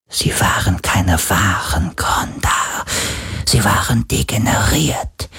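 slow, airy spirit whisper; an ancient ghost telling old history; ghostly whisper. Acted. Ghost recounts Kronda history.

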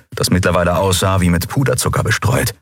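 ordinary delivery

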